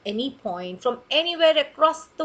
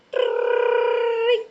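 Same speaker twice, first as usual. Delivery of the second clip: high ringing trill; alarm ring; 11 semitones higher, 4 dB louder than usual. Pretend. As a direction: ring like a little alarm clock. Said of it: "his alarm clock wouldn't go".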